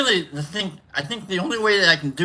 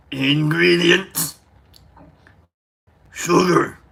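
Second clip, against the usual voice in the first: Cookie Monster voice; slower than usual. Pretend. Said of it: "in the voice of Cookie Monster".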